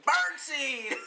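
high, loud mock holler; retold sideline yell. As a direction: yell it out suddenly, laughing. Acting out a sudden sideline yell.

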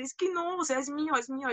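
imitating an earnest denial; retold protest. Retold protest against blaming friends.

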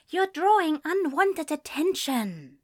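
high, prim reproach; fussy angel. Acted. Angel scolding the demon for drawing attention. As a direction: tut at him like a fussy angel.

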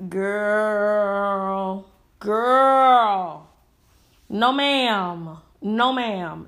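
long drawn-out disapproving vowels; exasperated scolding. Real, not acted. Commentator chiding rappers who only rap about sex, exasperated. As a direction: stretch each word in weary disbelief.

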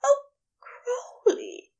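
high, slow gasp of pleasure. A narrator's reading. Aziraphale overcome by Crowley's touch, gasping. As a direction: gasp the name out, drawn out and high. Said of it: "he gasped".

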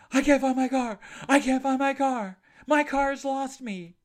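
high, loud frantic woman's voice. Retold or imitated. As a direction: play the panicked stranger, high and loud. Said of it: "And she says".

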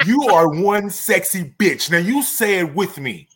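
high, loud, emphatic affirmation; hype-up pep talk. Pretend. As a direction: pump up a friend with mock fierceness. Hyped-up pep talk, demands repetition.

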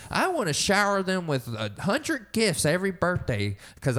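mock a doting parent gushing over their child. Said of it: "It's this soft pussy shit".

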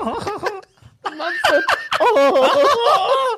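words breaking into laughter; can't stop laughing mid-line. Real, not acted. Laughing while retelling the story.

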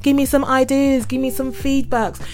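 stretched-out, upbeat closing plug; podcast sign-off. Real, not acted. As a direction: sing out your sign-off to listeners. Podcast host signing off to listeners.

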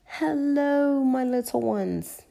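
high, lilting grandmotherly greeting; Granny Pig. A narrator's reading. Granny greeting Peppa and George, high lilt. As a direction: welcome the little ones fondly. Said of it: "answered Granny Pig".

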